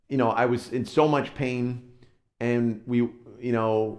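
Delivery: ordinary delivery, nothing marked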